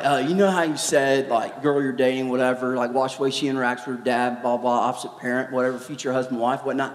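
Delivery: put-on low, mumbling kid voice; subdued low ramble, put on; imitating shaken teen. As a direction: mimic a rattled kid rambling along.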